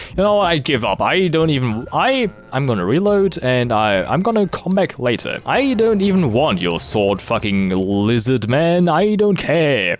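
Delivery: high-pitched, exasperated giving up; fed-up outburst. Real, not acted. Quits the attempt in frustration.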